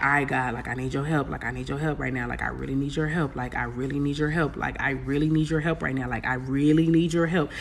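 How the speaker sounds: soft, low pleading prayer; quiet begging prayer; hushed prayer. Retold or imitated. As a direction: whisper a desperate plea to God. Retold prayer in crisis.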